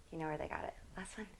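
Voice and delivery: whispered, teasing aside; conspiratorial whisper. Real, not acted. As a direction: let them in on the secret under your breath. Cheeky aside about copying instructors.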